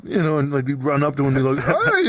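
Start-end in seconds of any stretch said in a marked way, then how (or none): 1.6-2.0 squeaky high hey; high greeting. Retold or imitated. Running up to greet a newbie, squeaky high. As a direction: pop it up high and cute.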